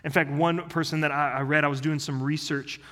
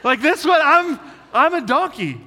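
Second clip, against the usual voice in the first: much higher and louder, exclaiming in sudden self-recognition; excited realization; 12 semitones higher, 10 dB louder than usual. Retold or imitated. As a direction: blurt it out as a delighted confession. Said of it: "I read that and I was like".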